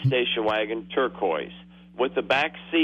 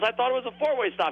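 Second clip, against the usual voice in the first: much higher and fast; flustered excuse; 10 semitones higher, faster than usual. Retold or imitated. Teen explaining himself to the examiner, high and rushed. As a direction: blurt the excuse out in a hurry.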